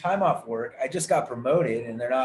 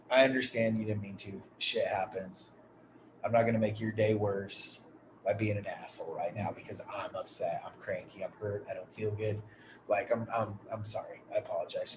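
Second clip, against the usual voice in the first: much softer, lower, calm apology trailing to a breath; soft retold apology; 6 semitones lower, 9 dB quieter than usual. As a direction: ease off and apologize gently, nearly whispering at the end. Retold apology to hospital staff.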